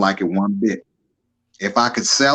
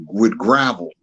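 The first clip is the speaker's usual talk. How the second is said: deep gravelly voice; 3 semitones lower, much slower than usual. Retold or imitated. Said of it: "one of the deepest voices, like they gargle with gravel".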